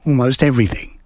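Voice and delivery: plain reading voice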